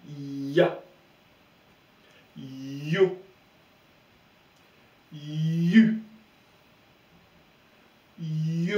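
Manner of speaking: drawn-out Y onset on each of "Ya. Yo. Yu. Ye.", tongue pushing; tongue-pressed Y glide. Shown as a demonstration. Teacher demonstrating the new French sound, tongue-pressed Y glide. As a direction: hold a long pressed Y, then release into the vowel in "Ya", "Yo", "Yu", "Ye". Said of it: "j'appuie un peu avec la langue".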